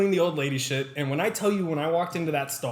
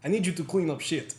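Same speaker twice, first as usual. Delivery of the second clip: put-on Russian accent; 2 semitones higher than usual. Russian boss asking the new guy a favor, Russian accent. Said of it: "applaud you for that Russian accent".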